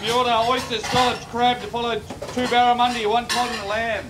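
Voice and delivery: high, loud chef's call; kitchen order callouts. Real, not acted. Chef calling orders.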